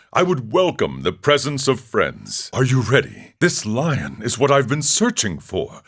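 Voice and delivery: ordinary delivery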